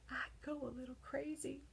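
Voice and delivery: plain reading voice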